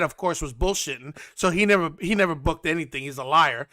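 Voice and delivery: normal talking voice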